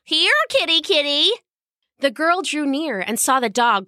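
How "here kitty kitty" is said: high coaxing call; high sing-song call to a kitten. Acted. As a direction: coax the kitty down sweetly. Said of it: "she called".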